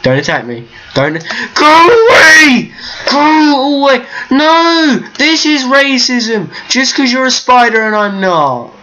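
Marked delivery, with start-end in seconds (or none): 1.5-8.7 high-pitched indignant panic; panicked shouting. Real, not acted. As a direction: yell at an attacker to get away. Spider attacks him in game.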